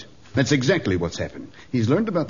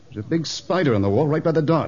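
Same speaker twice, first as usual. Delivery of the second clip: ordinary talk, nothing marked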